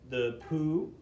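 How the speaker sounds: "the poo" with lips pushed forward in a flute embouchure; embouchure sound demo. Shown as a demonstration. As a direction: shape "poo" as if blowing across the flute. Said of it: "make your embouchure".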